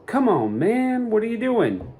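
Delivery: lightly hammed disbelief at stubborn people; exasperated plea. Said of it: "scratch your head and go".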